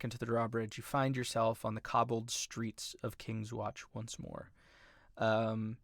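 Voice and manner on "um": usual speaking voice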